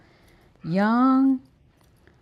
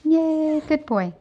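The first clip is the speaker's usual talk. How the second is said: a higher, louder cheer for the dog; excited praise; 8 semitones higher, 4 dB louder, slower than usual. Real, not acted. Praising dog after trick.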